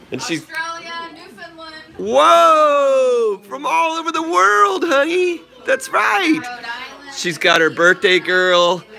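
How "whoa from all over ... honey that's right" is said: excited cooing. Real, not acted. Dad cooing excitedly to birthday daughter.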